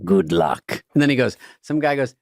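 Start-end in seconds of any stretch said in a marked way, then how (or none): 0.0-0.8 low, drawn-out villain quote; good luck voice. Retold or imitated. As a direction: imitate a movie villain, low and deliberate. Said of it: "That's the good luck voice".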